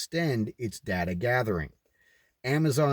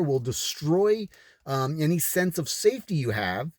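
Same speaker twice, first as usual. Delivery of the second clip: mocking sinister elites; 6 semitones higher than usual. Mimicking elites telling viewers not to work, mock sinister. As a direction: ham up the elites' promises, sneering.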